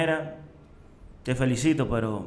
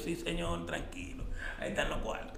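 much softer, calming reassurance to the father; soothing; 4 semitones higher, 12 dB quieter than usual. Retold or imitated. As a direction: hush him gently, everything's fine. (hijo calmando al papá, voz muy suave)

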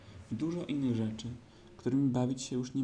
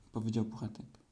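ordinary delivery

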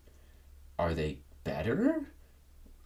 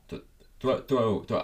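higher, louder repeated throws; emphatic throw calls; 4 semitones higher, 4 dB louder than usual. Acted. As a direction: punch out each throw. Demonstrating the throwing motion.

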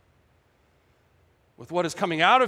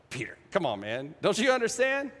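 good-humoured exasperation; 4 dB quieter than usual. Shown as a demonstration. Jesus gently chiding Peter for not understanding, fond exasperation. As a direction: chide a friend lightly, coaxing rather than angry. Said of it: "I think it's like".

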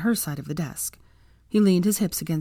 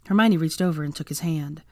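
plain reading voice

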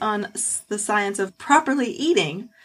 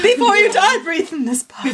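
mock urgent voice; 9 semitones higher, 4 dB louder than usual. Playing Henry Ford.

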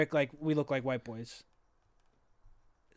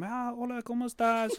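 put-on higher, softer greeting in a Mexican Spanish accent; 6 semitones higher, 4 dB quieter than usual. Greeting the little lady at the bakery in a Mexican Spanish accent. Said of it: "let me say it in the accent".

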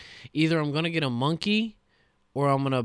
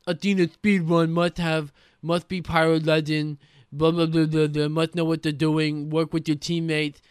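mocking post impression; 3 semitones higher, 5 dB louder than usual. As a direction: parody the demanding posters at full volume. Mocking looking-for-group posts.

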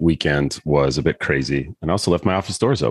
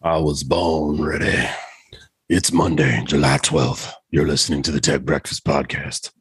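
cartoon monster voice; 4 semitones lower than usual. Host opening the podcast as a joke, in a low monster voice. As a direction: put on a deep cartoon monster voice. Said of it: "spot on the way that character sounds".

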